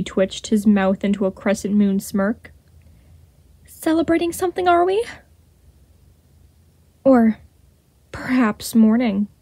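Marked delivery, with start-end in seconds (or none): 3.7-9.3 high, fast, silky mocking villain; cool taunt. A narrator's reading. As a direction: sneer it lightly, high and quick.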